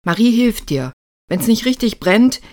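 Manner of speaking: loud, high-pitched bossy orders; commanding. A narrator's reading. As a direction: order them about at full voice. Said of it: "befahl er Lukas".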